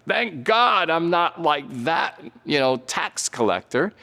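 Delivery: high, proud, looking down on others; smug Pharisee. Retold or imitated. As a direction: sneer at the tax collector. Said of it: "just like the Pharisee".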